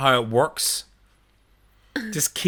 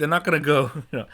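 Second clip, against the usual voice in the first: ordinary delivery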